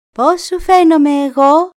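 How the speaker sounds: high, loud deer call. Acted. Deer calling loudly to the raven, high. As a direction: shout it up to the raven. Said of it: "και του φώναξε".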